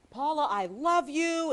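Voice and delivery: high, slow, loud lovesick cry across the courtroom; a stalker calling out his love, drawn out; lovesick shouting. Retold or imitated. Stalker calling to the reporter across court, high slow lovesick shout. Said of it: "he would carry on in court".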